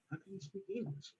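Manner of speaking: hushed disbelief; imitating the doorman.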